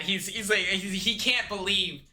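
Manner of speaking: ordinary talk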